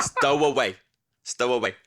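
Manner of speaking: "Stowaway" with every syllable sounded; clear pronunciation. Shown as a demonstration. Singer showing the bass player how it's said, clearly pronounced. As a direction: show off all three syllables of "Stowaway". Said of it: "that's why I'm the singer".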